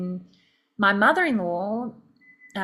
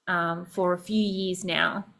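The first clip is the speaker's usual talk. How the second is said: ordinary delivery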